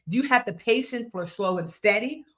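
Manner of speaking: ordinary talk, nothing marked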